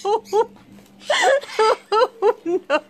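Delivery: high, helpless laughing protest; laughing dismay. Real, not acted. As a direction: protest weakly through a laughing fit. Still laughing at the outfit.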